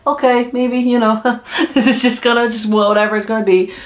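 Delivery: high, airy mock shrug, whatever happens; resigned shrug. Sitting in the meeting, resigned to whatever, a mock shrug. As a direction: let it go with a light shrug.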